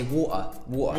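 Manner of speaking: "water" as wa'er, London style; Cockney T dropping. Shown as a demonstration. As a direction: say "water" with no T, just a catch in the throat. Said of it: "you speak like Cockney".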